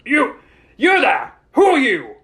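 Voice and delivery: high, loud challenge; demanding. A narrator's reading. Estate superintendent confronts strange girl, loud and demanding. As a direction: fire off the words as a hard demand. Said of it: "he demanded".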